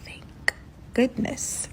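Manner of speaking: very soft, breathy retold relief; hushed relief. Woman to herself as fuel runs out, hushed relief. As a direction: sigh it out quietly, barely there.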